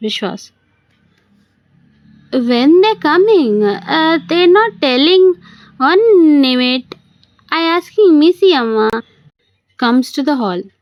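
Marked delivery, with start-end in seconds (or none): 2.5-8.9 put-on atrocious South Indian accent. Man poses as the butler on the phone, bad South Indian accent. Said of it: "Puts on an atrocious South Indian accent".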